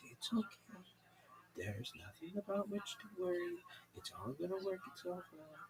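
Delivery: soft, settling reassurance; calm host. Retold or imitated. Radio host soothing the caller, soft and calm. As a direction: fade the voice down soothingly. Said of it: "Just the calmest way".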